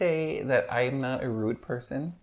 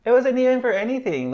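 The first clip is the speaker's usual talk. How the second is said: high, louder indignant exclaim; exasperated outburst; 11 semitones higher, 6 dB louder, faster than usual. Retold or imitated. Venting to a friend about being angry, exasperated high exclaim. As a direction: voice jumps up in disbelief. Retold inner outburst, much higher.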